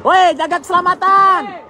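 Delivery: high urgent shout to back off; shouting warning. Real, not acted. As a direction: yell a warning over the crowd. Crowd near fire, warning people. (teriak memperingatkan)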